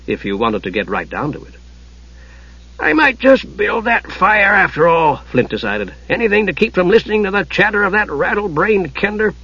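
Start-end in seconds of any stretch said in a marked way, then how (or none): 2.8-5.2 high, slow dwarf voice; grudging dwarf. A narrator's reading. Dwarf decides to light the forge, grudgingly. 6.1-9.3 higher-pitched cranky dwarf; grumbling dwarf. A narrator's reading. Dwarf escapes the Kender's chatter, grumbling.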